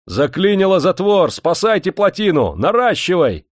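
high, urgent shouted orders; angry alarm shout. A narrator's reading. Dam master in icy water warning the crowd, angry alarm shout. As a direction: cry out the emergency at full pitch. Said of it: "потемневшие от гнева глаза и прохрипел".

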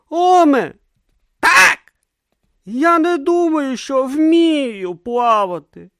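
high, loud accusing yell. A narrator's reading. Brutha yelling at Om in the sea, accusing. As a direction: throw his words back at him.

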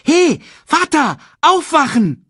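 put-on high, loud child's wake-up call. A narrator's reading. Cub tries harder to wake his father, loud high call. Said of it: "Simba sprach etwas lauter".

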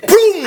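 high, loud mock bang; door slam. Door slammed on piglet.

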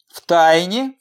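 a scoffing, rising В тайне; mild mocking scoff; scornful snort. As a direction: toss it back with a dismissive snort. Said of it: "фыркнула Зальцберг".

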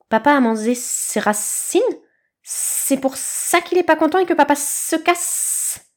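put-on slow, childlike snake voice; puzzled small snakelet, slowly; little snake child. A narrator's reading. Snakelet asking about his papa, slow and lost.

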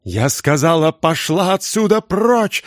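high, slow, desperate yell; desperate wail. A narrator's reading. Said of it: "завопила она ещё отчаяннее". (отчаянный вопль, высоко и медленно)

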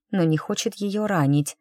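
ordinary delivery

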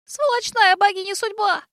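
high little squeak, slowed; tiny squeaking voice; squeaky voice. A narrator's reading. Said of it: "пропищало оно".

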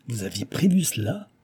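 usual speaking voice

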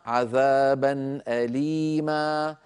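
slow "عذابا أليما", drawn-out level vowels; tajweed recitation. Shown as a demonstration. Teacher reads example of nasb tanween, slow tajweed recitation. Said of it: "نرى الأمثلة أمامنا".

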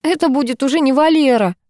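high, tragic little squeak; squeaked protest. A narrator's reading. Owner refusing neighbour's replacement offer, squeaking tragically. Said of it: "пискнула я".

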